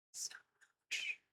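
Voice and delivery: faint tired whisper. Real, not acted. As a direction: breathe it out, spent. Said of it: "Today was exhausting".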